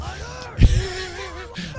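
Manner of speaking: high burst of helpless laughing; hard real laughter. Real, not acted. Player can't finish his favorite Bannerlord story, laughing hard. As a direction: break down laughing mid-sentence. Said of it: "I'm laughing so hard".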